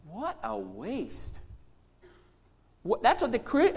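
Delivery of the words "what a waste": soft, slow, sneering murmur; quiet scornful aside. Pretend. Fellow Christians belittling the gift, quiet slow scorn. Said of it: "Other Christians may even say".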